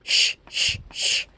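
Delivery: voiceless sh sh sh, breath only; shushing sound. Shown as a demonstration. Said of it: "hay una más".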